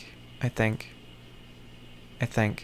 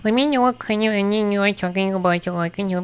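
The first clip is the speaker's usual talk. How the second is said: higher, chipper assistant read; mock AI voice; 6 semitones higher than usual. Reading the AI overview aloud, lightly mocking chipper voice. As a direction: read it like a cheery chatbot. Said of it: "A I Overview says".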